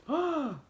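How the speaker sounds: very high, excited gasp. Acted. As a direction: gasp with delight. Door opens.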